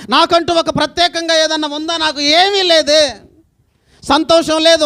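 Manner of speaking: full-voiced lament from the pulpit; impassioned preaching. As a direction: preach her despair out to the crowd. Long impassioned lament in woman's voice.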